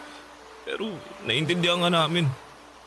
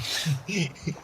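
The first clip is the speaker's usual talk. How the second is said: quiet little chuckle, trailing off; soft laugh; 6 dB quieter than usual. Acted. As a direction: let a small laugh slip out. Friends share bittersweet win. (mahinang tawa)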